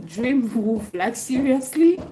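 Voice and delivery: amused, laughing it out; laughing disbelief. Real, not acted. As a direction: laugh through the question. Laughing at viewer comment.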